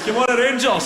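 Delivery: loud, high football chant shout. Retold or imitated. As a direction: bellow it at the crowd like a fan. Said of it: "He shouts".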